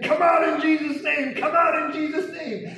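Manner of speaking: high, forceful exorcism cry; commanding shout. Retold or imitated. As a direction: shout it as an order to a demon. Retelling his command to a demon.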